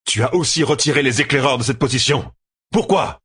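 high-pitched, heated interrogation; accusing demand. A narrator's reading. Commander asks why scouts were withdrawn, accusingly. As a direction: press the charge, then leap up on the question.

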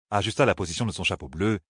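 usual speaking voice

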